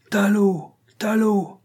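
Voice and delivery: angry crowd howling. A narrator's reading. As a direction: bellow it as an enraged mob. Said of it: "hurlaient maintenant les Hatchés".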